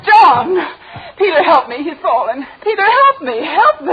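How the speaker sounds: high, loud cries for help; wife screaming as her lover collapses, frantic; panicked cry. Acted.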